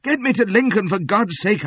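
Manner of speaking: high, loud desperate shout. A narrator's reading. Wounded fugitive begging to be taken to Lincoln, high loud shout. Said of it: "I shouted".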